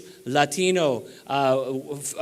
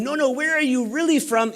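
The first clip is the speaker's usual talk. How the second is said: pitched higher, pressing harder, a touch mocking; the anchor pushing a third time, lightly imitated; higher insistent question; 6 semitones higher than usual. News anchor asking a third time, higher and insistent. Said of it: "she asked me a third time".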